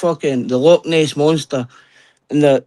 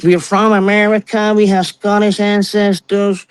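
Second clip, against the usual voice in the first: mimicking tourists; 6 semitones higher, slower than usual. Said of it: "And I'm like".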